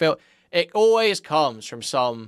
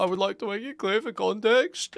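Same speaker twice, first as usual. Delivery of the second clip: mocking a tearful, strained voice, much higher; choked up voice; 7 semitones higher than usual. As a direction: imitate a choked-up apology, pitched up. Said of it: "put on his same choked up voice".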